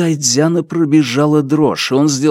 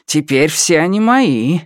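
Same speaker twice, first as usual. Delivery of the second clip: mildly gloating, lilting tone; smug, swooping taunt; sarcastic smile; 4 semitones higher than usual. A narrator's reading. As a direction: rub it in with a caustic smile. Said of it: "сказал он с язвительной улыбкой".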